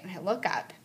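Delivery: normal talking voice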